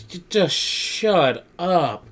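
"just shut up" stretched very slowly, high; slow, high mock exasperation; exasperated drawn-out retort. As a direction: drag out each word of "just shut up". [name] silencing the insults.

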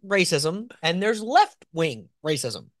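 slow, deliberate spacing between words; slow, careful articulation. Real, not acted. Host spelling out two kinds of racism, slowly and carefully. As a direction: pick through the tongue twister slowly. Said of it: "having to say it slowly".

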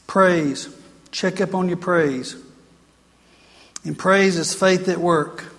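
ordinary talk

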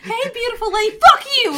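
repeating the line through real laughter; cracking up while quoting; laughing. Real, not acted. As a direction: giggle it out, ending in a loud laughing burst. Mocking friend's line while laughing.

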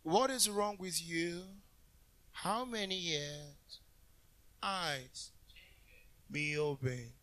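soft, low, drawn-out mock-holy murmur; mock gentle Jesus. Preacher mocking people's idea of Jesus, soft and slow. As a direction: speak softly and slowly, mocking a meek Jesus. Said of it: "Jesus always walked like this".

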